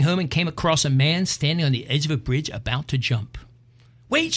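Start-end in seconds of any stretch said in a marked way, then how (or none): 4.1-4.3 woman's voice pitched far up, calling out; high woman's yell. Acted. Woman on bridge yells to jumper, high and urgent. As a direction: shout high to stop him jumping. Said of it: "she yelled".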